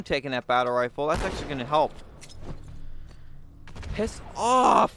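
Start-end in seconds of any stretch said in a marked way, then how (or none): 3.9-5.0 higher-pitched irritated outburst; angry yell. Real, not acted. Player to game enemy, angry yell. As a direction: snap it at an attacker, voice jumping up.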